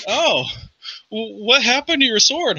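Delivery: high, hesitant question; concerned party member. Acted. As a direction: ask carefully, stumbling into it. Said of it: "He's like".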